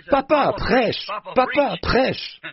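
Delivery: imite un petit-fils qui appelle, voix bien plus aiguë mais pas plus forte; cri d'enfant imité. Retold or imitated. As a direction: appel d'enfant mimé, aigu, sans vraie force. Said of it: "Il disait". (petit-fils qui réclame le prêche, cri aigu imité)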